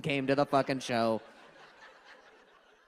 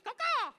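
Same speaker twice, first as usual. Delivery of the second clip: very high-pitched crow squawk; cartoon crow call; bird caw. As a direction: let out two high caws like a bird. Imitates bird between chanted drug words.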